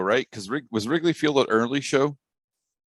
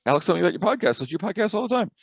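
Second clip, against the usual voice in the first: higher, mock girlish voice; imitating young woman; 9 semitones higher than usual. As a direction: lightly voice an excited young fan. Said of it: "She goes".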